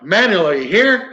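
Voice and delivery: retold calling out. Investigator calls to the spirit in the house, calling out.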